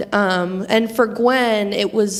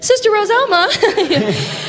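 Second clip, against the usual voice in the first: calling out; 10 semitones higher, 4 dB louder, slower than usual. Real, not acted. Calling out to the sister.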